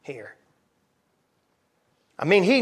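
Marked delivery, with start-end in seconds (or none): 0.0-0.4 Pilate's inner thought. Acted. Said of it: "Pilate's thinking".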